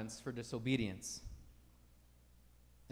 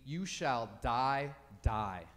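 You shall die, very slow, the second die lower; slow emphatic repeat; 3 semitones lower, much slower than usual. Shown as a demonstration. Preacher showing the Hebrew doubling, slow and grave. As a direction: stretch it out, then drop die. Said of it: "if we were reading this in Hebrew".

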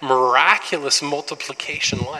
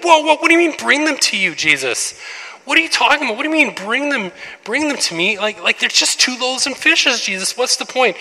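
high, loud mock protest; incredulous disciples; 11 semitones higher, 6 dB louder than usual. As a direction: play the baffled disciples objecting. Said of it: "And they're like".

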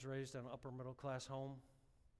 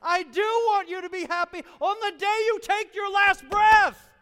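higher, louder, slow shout; booming divine answer; God's shout; 27 dB louder than usual. Retold or imitated. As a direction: thunder God's reply. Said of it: "he's like".

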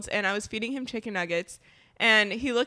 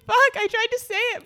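high, louder exasperated blurt; mock frustration; 11 semitones higher, 6 dB louder than usual. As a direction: blurt it out annoyed at himself. Boyfriend's frustrated admission.

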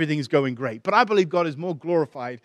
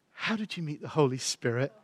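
hushed, tender quoting of a woman; gentle; 8 dB quieter than usual. Retold or imitated. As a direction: slip the question in very softly. Voices Heidi's tender, pointed question.

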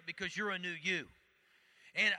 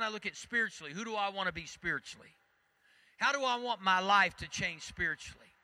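ordinary delivery

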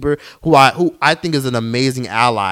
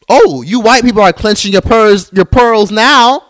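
high, loud, drawn-out taunt; taunting mock shock; 7 semitones higher, 6 dB louder than usual. Real, not acted. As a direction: crow at their outrage, high and loud.

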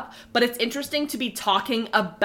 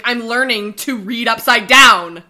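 mock proud; 8 dB louder, slower than usual. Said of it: "And she's like".

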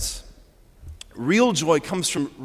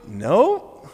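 lilting, animated no; joyful denial; 3 dB louder than usual. Acted. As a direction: say no as if bursting with news. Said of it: "this is what I heard".

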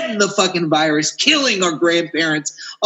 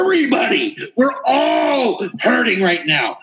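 exasperated outcry; 3 semitones higher than usual. Real, not acted. Ranting host tells bored partygoers everyone hurts, exasperated wail.

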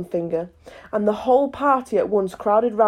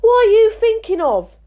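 much higher and louder snap; stern demand; 13 semitones higher, 6 dB louder than usual. A narrator's reading. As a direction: demand it sharply.

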